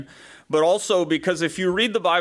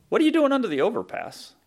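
quick, higher-pitched mock challenge; incredulous challenge; 9 semitones higher, faster than usual. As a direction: question them as a baffled sceptic would. Voicing sceptics challenging believers' refuge.

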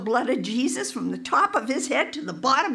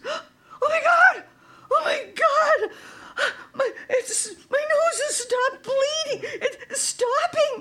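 high-pitched gasping disbelief, imitated; tearful amazement; 14 semitones higher than usual. Healed man realizing his nosebleed stopped, amazed and tearful. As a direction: cry out in overwhelmed astonishment.

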